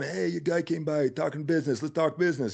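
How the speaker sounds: high, gruff Philadelphia mobster; Philly mafia guy. Retold or imitated. Mafia garbage man saying let's talk business, mock tough guy. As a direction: play the pinky-ring mafia guy sizing up a newcomer. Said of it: "They all got silk shirts".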